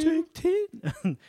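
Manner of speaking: high, loud mock British lady; hammed-up British woman in falsetto; British woman accent. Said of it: "a woman with a British accent".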